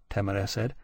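usual speaking voice

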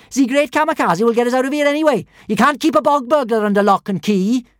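shouting. A narrator's reading. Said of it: "shouted Kamikaze".

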